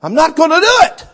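mock defiant refusal. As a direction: act out a pouting believer. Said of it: "The reaction down in the heart's the same".

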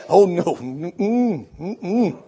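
a scared man quietly declining; mock timid refusal. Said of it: "like that first fellow did".

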